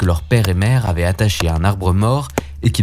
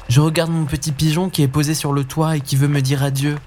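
lightly voiced young boy, a bit higher; just a hint of a comforting little brother; gently raised boy voice; Hansel; 7 semitones higher, 4 dB quieter than usual. Hansel answering at the house.

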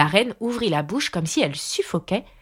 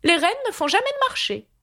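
very high, haughty declaration; lofty Red Queen. A narrator's reading. Red Queen refusing Alice's bargain, high lofty voice. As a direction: proclaim it from on high. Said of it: "se redressa rapidement de toute sa hauteur en déclarant".

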